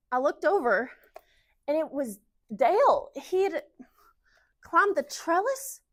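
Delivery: lovestruck Southern storyteller; Southern girl reliving a romance; Southern wife Kara. Acted. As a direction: gush about first love in a Southern accent. Resumes Kara's monologue.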